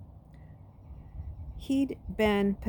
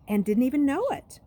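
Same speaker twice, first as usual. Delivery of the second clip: put-on girl's voice; 7 semitones higher, 7 dB louder than usual. Nina's voice continues.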